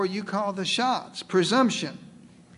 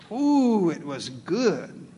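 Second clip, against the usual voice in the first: relishing; much slower than usual. Acted. Savoring the land's beauty.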